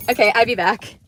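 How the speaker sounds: ordinary talk, nothing marked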